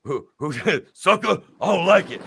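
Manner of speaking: high, loud mock outrage; hammed-up wrestler imitation; mock Booker T. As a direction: squawk it like an offended wrestler. Retelling Booker T's reply.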